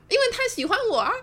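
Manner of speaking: high, loud, fast mock brag; cheeky retort. Speaker answering the angry friend, cheeky. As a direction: toss it back gleefully, pitched right up.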